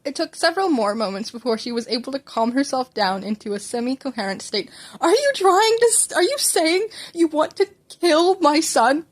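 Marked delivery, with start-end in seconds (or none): 5.0-9.0 higher, louder, slow horrified outburst; sputtering outrage. A narrator's reading. Mother to doctor who suggests stopping her son's heart, outraged. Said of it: "Inko began to sputter".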